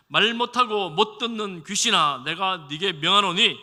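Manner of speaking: loud, slow, commanding rebuke; stern rebuke. Retold or imitated. Jesus rebuking the spirit, loud, slow and commanding. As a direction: command the spirit to leave, slowly and forcefully. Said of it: "귀신을 꾸짖습니다".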